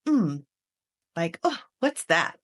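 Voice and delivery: imitating a child's sassy snippy reply; mock a kid's attitude, rising on the question. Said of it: "in their voice".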